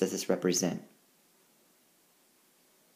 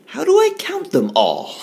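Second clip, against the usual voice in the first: cried; 14 semitones higher, 7 dB louder than usual. Acted. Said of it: "I cried".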